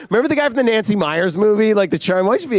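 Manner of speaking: high, eager pitch of an idea; coaxing director. Retold or imitated. Director retelling his note to Keanu, high and eager. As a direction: talk the actor into charm. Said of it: "I'm like".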